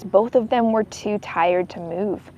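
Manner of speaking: plain reading voice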